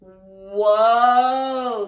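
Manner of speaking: plain reading voice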